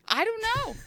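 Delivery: high squeaky voice, playing dumb; squeaky dodge. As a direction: pipe it up high, feigning cluelessness. Joking dodge with laughter.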